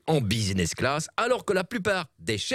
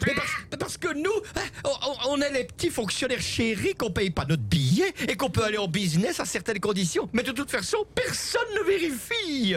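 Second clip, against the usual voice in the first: greffier character voice; 9 semitones higher than usual. Acted. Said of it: "le greffier du Parlement wallon".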